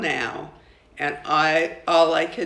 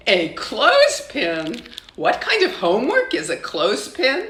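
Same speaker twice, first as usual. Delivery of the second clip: high, louder, quick puzzled mother; a baffled mother's voice, pitched up; surprised mother; 5 semitones higher, 5 dB louder than usual. A narrator's reading. Mother asks her daughter, surprised and puzzled. Mama puzzled by clothespin homework.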